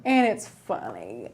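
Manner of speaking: low goofy voice. Shown as a demonstration. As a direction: drop into a deep joke voice. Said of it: "repeated in put-on voice".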